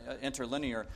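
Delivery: normal talking voice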